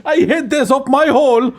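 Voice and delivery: high mock-Irish confession; exaggerated Irish accent; Christopher Walken gone Irish. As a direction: parody the monologue in stage Irish. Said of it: "Christopher Walken in Pulp Fiction, but Irish".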